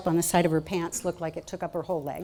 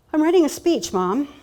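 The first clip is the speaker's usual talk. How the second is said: higher and louder, a bit fed up; exasperated reply; 7 semitones higher, 10 dB louder than usual. Retold or imitated. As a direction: push it out impatiently at mom.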